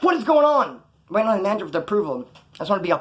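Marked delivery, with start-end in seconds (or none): 0.0-0.8 much higher, louder frantic cry; exasperated panic. Retold or imitated. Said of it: "I'm like".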